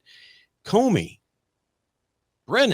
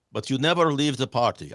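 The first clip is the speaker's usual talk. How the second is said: bad Russian accent. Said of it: "I wish I could do a real Russian accent for you".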